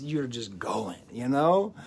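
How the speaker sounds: ordinary talk, nothing marked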